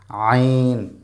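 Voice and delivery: আইন squeezed from mid-throat, long vowel; pressed throat sound. Shown as a demonstration. Teacher models Arabic letter, pressed throat sound. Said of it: "কণ্ঠনালীর মাঝখানে চাপ দিয়ে".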